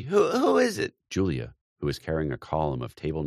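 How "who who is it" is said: high, worried question; anxious question. A narrator's reading. Aunt Kate asking Julia what's wrong, high and anxious. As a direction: ask in sudden alarm. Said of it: "asked Kate anxiously".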